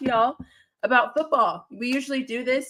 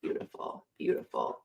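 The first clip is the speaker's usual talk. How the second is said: whisper; 11 dB quieter than usual. Real, not acted. Whispered while setting up.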